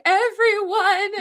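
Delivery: high, loud slowed wail of Everyone; retold crying wail. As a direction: cry out Everyone as if breaking down in tears. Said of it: "and crying".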